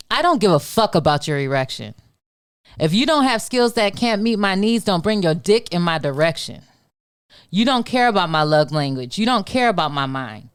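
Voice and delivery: spoken-word poetry performance; spoken word poem. Acted. Performs her poem IDGF.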